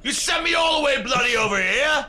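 shouting. Acted. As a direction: call it out high and laughing. Yelling to friends across street.

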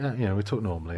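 normal talking voice

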